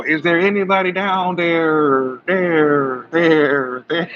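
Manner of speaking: high, slow call down into an echoing hole. Pretend. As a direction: call out and let it echo. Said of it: "I wonder if it echo".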